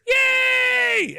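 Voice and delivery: high, loud hammed-up cheering; mock crowd cheer. Crowd cheering the wrestler, high mock cheer. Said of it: "Everyone goes".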